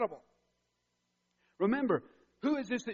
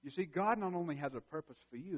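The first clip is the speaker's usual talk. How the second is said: ordinary delivery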